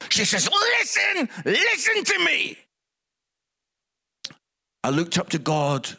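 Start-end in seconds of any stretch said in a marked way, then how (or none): 0.5-2.6 high, heated mock outburst; imitating an angry, insistent spouse; angry wife. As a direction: demand attention like a furious wife. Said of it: "Tamsin was angry".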